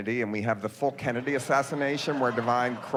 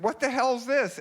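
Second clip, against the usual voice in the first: outraged mother; 11 semitones higher than usual. Retold or imitated. Divine's mother finds the bloody outfit, high outraged demand. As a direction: pitch it up like a scandalized mom. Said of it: "And she said".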